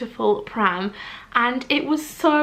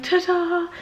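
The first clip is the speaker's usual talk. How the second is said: high, playful ta-da; showy reveal; 8 semitones higher than usual. Pretend. Rehearsing the reveal for her friend, showy. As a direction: sing out a mock unveiling. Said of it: "be like".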